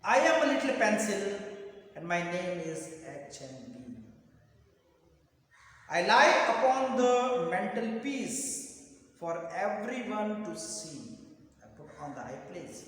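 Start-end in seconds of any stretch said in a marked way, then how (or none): 0.0-11.2 loud, deliberate recitation of a poem; slow poem reading. Shown as a demonstration. Teacher reads the poem to students so they understand, slow recitation. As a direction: read it out loudly, line by line. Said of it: "I'll read the poem slowly".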